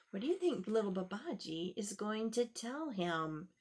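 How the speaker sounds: ordinary delivery, nothing marked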